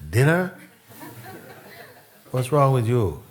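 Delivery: a burning husband lashing out at his wife over dinner, loud and high; angry outburst. Retold or imitated.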